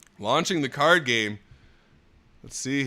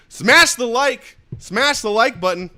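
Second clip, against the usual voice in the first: higher, loud mock hype; failed put-on voice; 8 semitones higher, 7 dB louder than usual. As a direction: punch it out in a silly voice. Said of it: "failing miserably at that".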